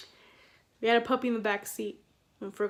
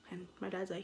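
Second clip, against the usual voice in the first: ordinary talk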